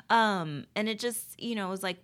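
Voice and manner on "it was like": ordinary talk, nothing marked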